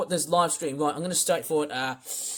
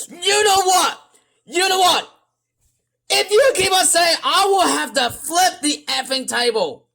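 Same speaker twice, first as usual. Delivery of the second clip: high-pitched exasperated yelling; raging; 10 semitones higher, 4 dB louder than usual. Real, not acted. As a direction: rant at them loud and high. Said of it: "I've never ever raged so hard".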